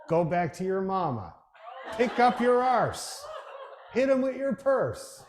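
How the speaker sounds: hammed-up yell at the players, slow and high; mock yelling fan. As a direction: imitate a furious old hockey fan, drawn out. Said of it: "he screamed at his own players".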